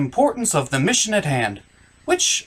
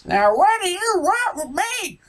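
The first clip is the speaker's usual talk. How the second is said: high woman's voice; 12 semitones higher than usual. Acted. Mother voiced in the parody.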